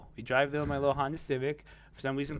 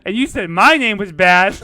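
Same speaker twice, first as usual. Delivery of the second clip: much louder, higher sarcastic retort; loud scoff; 4 semitones higher, 15 dB louder than usual. Retold or imitated. Mocking the gangster's name, a loud sarcastic comeback. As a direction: fire back at the tough guy loudly. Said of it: "And I was like".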